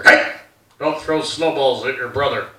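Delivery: low mock-grave dad; dad's warning. Dad warns son about snowballs, low and stern. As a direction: bark "Hey", then drop to a low fatherly caution. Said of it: "My dad used to recycle stupid shit".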